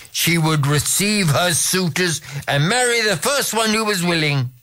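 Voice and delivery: plain reading voice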